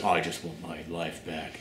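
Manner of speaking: low, quiet, lifeless sigh of a line; flat monotone impression. Reviewer mimics the heroine's low, flat final line. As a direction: mumble it low and drained. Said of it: "she goes".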